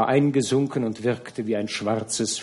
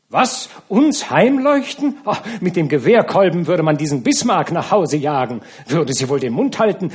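viel höher und lauter, entrüstet; empörter Aufschrei; 6 semitones higher, 7 dB louder than usual. Acted. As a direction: schrei entrüstet auf. (empört und laut, stark erhöht)

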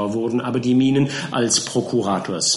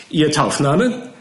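bullying counsel; 5 semitones higher, 4 dB louder, slower than usual. A narrator's reading. Counsel questions.

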